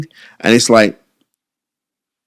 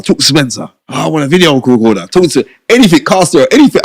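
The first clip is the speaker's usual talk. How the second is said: higher and louder, piling it on; hyped-up boast; 8 semitones higher, 6 dB louder than usual. Retold or imitated. Boasting to the interviewer about always being the fixer, excited. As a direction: rattle off every deal excitedly.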